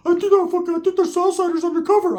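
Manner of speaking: very high, squeaky mock voice; high cartoon voice imitation; Ricky's voice. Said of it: "I said".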